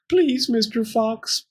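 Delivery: high, slow pleading whimper. A narrator's reading. Pearl begging the fox for her bone, whimpering. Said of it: "Pearl whimpered".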